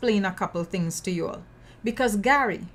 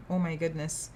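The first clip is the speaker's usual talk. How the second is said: ordinary talk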